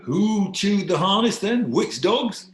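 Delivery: loud, high-pitched demand; challenging king. Acted. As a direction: challenge the accuser, pitch climbing to the end.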